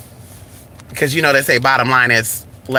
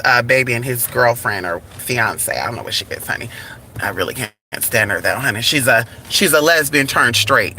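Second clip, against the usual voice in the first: ordinary delivery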